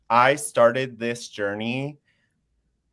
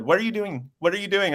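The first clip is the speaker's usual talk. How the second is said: excited asking; 7 semitones higher, faster than usual. Retold or imitated. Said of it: "she was just like".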